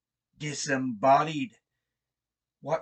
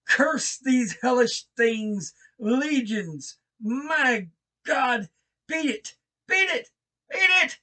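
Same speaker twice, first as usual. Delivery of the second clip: high, loud cry building to a shriek; a high shout building into a shriek of horror; cry rising to shriek; 11 semitones higher, 5 dB louder than usual. Acted. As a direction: let the terror climb to a final shriek. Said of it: "a cry that gradually rose to a shriek".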